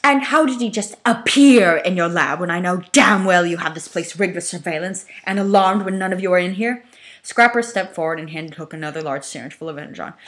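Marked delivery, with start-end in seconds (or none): 0.0-6.8 angry demand. Acted. Megatron grilling Hook about his lab. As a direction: press the accusation hard.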